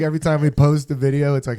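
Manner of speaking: ordinary delivery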